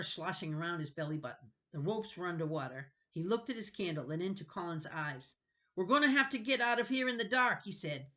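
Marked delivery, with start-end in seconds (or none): 5.7-7.6 urgent. Acted. Urgent decision in rising water.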